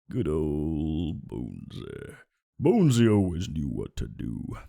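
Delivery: deep, slow late-night host; low slow radio host. Pretend. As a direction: lean into a drawled midnight radio voice. Low drawn-out voice.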